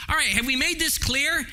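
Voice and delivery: normal talking voice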